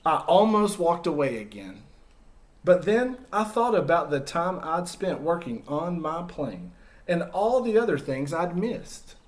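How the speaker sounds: put-on storytelling to kids as Humpty, lively and expressive; story narration. Teacher reading Humpty's story to children, expressive narration. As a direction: read it like a picture book to a class. Reads picture book to children.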